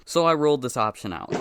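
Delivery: normal talking voice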